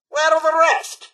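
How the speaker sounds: high, loud, affronted question; indignant demand. Acted. Buyer finding the egg delivery short, indignant. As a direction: demand an explanation, aghast.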